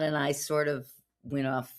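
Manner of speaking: normal talking voice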